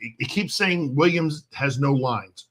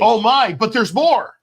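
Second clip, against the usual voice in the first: loud, excited TV salesman; infomercial voice; 6 semitones higher, 5 dB louder, slower than usual. Acted. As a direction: pitch it like a late-night ad. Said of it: "I sound like an infomercial".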